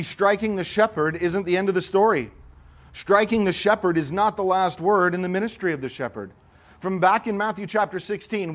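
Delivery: high, loud and driving sermon delivery; full-force preaching. Acted. Preacher urging the congregation that death is not the end, ringing out high and loud.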